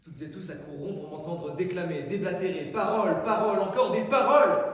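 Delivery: from strong to loud and high; building to a shout. Acted. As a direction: pile the words up into a shout. Quotes [name] song, building. (crescendo jusqu'au cri)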